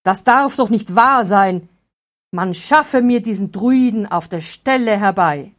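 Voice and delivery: loud, commanding outrage; furious count. A narrator's reading. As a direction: shout the order at the guards. Count enraged, demands druid. (wütender Befehl des Grafen)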